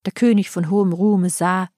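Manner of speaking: usual speaking voice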